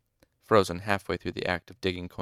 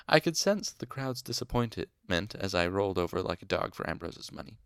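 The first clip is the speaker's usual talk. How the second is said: ordinary delivery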